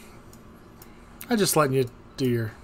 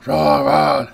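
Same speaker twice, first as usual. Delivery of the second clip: much louder brute bellowing; Strong Mad roar; 9 dB louder than usual. Acted. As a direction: roar it like a dumb muscle brute. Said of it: "strong mad".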